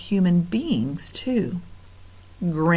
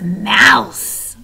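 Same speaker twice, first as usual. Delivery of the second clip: rat voice; 3 semitones higher, 7 dB louder than usual. Acted. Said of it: "agreed another".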